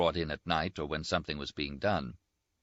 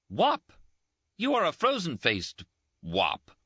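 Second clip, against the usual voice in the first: high, louder indignant retort; mock indignant; 12 semitones higher, 4 dB louder than usual. Rinaldi stung by the slur, indignant and louder. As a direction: throw the insult back. Rinaldi insulted, hits back.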